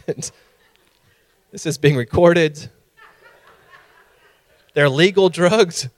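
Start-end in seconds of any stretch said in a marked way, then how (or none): none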